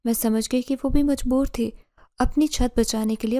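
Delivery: plain reading voice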